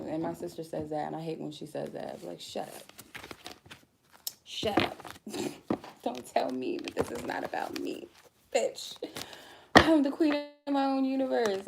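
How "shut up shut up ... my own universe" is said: sassy mock retort.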